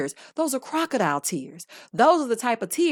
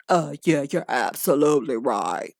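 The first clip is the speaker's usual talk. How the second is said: lower, lazy mocking mumble; mock husband; 8 semitones lower than usual. Host mocking husband's reply to therapist, low and dopey. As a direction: drag it out like a dim husband.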